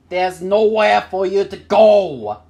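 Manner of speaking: loud, high taunting threat; yelling from outside. A narrator's reading. Robert outside warning Jack he is trapped, yelling. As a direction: holler through the door at someone trapped. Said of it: "Robert yelled from outside".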